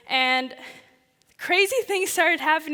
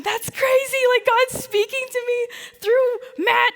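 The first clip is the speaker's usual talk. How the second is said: much higher, awestruck excitement; amazed realization; 9 semitones higher, 4 dB louder than usual. Retold or imitated. Student missionary realizing God speaks through Matt, awestruck. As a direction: let the wonder lift the voice way up.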